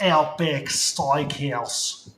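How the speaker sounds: slow, stretched fake Australian accent; mock Aussie accent. Joking with a friend in a drawn-out Australian accent. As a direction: drawl it like a cartoon Aussie. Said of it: "work on your Aussie accent".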